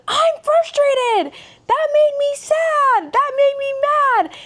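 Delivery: high, loud, drawn-out mock toddler wail; frustrated small nephew. Aunt imitating her overwhelmed nephew naming his emotions, mock frustrated. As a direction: imitate an overwhelmed little kid, slowly and very high. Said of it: "overstimulated or frustrated".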